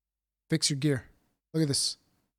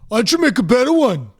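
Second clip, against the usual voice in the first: mocking quote; 4 dB louder, faster than usual. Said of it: "so incredibly fucking dumb".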